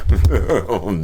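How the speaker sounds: short chuckle between words; laughing. Real, not acted. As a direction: let a small laugh slip out. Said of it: "I'm laughing because".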